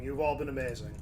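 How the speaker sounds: ordinary talk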